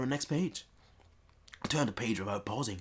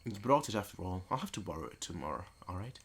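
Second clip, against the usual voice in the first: normal talking voice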